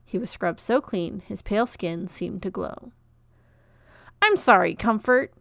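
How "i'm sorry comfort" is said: put-on loud scraping boy's voice on the apology; sandpaper screech. A narrator's reading. Peach apologizing to Comfort in a sandpaper screech. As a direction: rasp out the apology at full volume. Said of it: "said Peach in his sandpaper screech".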